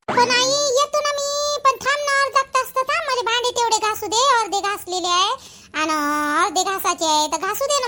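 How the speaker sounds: high pleading daughter voice; lightly voiced daughter. Daughter pleading to finish dishes.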